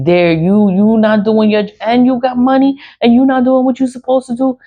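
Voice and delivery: ranting at the people with money and power, pitch rising; building heated rant. Real, not acted. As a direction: get worked up and rush it. Ranting at negligent officials.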